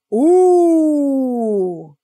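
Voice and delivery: long, loud ooh sliding down from high; high falling gossip ooh; intrigued ooh. Real, not acted. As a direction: draw out a big scandalized "ooh". Reacts to celebrity gossip.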